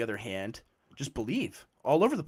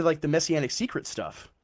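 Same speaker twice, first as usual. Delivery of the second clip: ordinary talk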